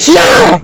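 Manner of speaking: high, sudden mock war cry; battle yell. Recapper acts out Naomi's attack, high-pitched yell. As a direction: yelp it out like an attack.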